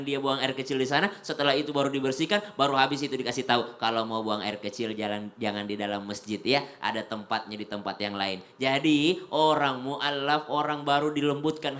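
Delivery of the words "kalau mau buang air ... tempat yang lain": softly, kindly explaining; calm, patient reassurance; gentle correction. Retold or imitated.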